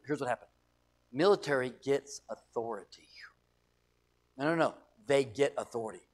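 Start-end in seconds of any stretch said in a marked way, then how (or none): none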